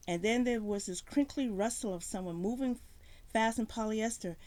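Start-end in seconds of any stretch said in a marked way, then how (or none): none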